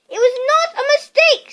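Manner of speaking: high, loud angry retort; angrily. A narrator's reading. Harry retorts angrily to Uncle Vernon. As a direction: snap back in anger. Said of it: "said Harry angrily".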